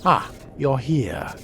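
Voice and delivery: ordinary talk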